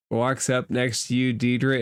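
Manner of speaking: ordinary talk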